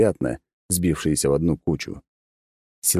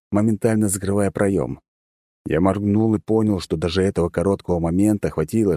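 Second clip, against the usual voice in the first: ordinary delivery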